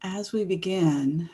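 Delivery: gentle guiding voice with long pauses; guided meditation. Real, not acted. Host inviting everyone to think about the world around them, guided meditation. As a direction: ease listeners in, leaving space after each phrase.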